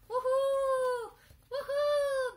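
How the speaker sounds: high shouted woohoo; excited cheering. Real, not acted. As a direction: whoop with joy. Dog finishes course.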